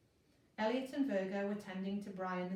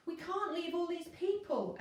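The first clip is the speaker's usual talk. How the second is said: higher, urgent shout; shouted back; 9 semitones higher, 3 dB louder than usual. A narrator's reading. Elliot shouting back at Virgo from the window, urgent. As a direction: call it back over the wind. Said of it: "Elliot shouted back".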